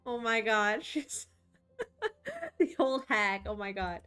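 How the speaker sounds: laughing. Real, not acted. Streamer laughs about old hag.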